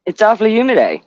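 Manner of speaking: high, sing-song Canadian "eh"; Canadian accent. Retold or imitated. Canadian impression after eh talk.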